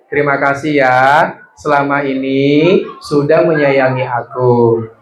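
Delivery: put-on slow, phrase by phrase, for the children to repeat; slow dictation. Shown as a demonstration. Teacher dictating to children, slow and phrase by phrase. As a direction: lead the kids word by word, slowly. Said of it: "Bilang sama orang tuanya".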